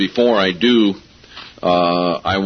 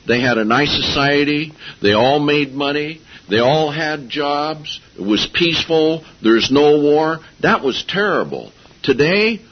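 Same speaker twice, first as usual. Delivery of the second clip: mock-earnest list, pitched higher, rising item by item; facetious; 5 semitones higher than usual. Real, not acted. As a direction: cynical irony in a raised, measured sing-song. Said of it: "I'm being very facetious".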